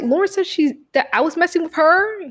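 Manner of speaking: high incredulous question. Retold or imitated. To the principal, high incredulous question. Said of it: "I was like".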